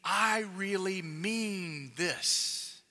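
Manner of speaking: earnest insistence. Shown as a demonstration.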